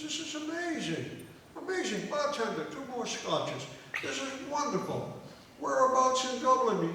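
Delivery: loud, high, gleeful wonder; exclaiming amazement. Acted. Drinker marvelling at his countryman, exclaiming amazement. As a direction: marvel loudly, each phrase swooping down from high.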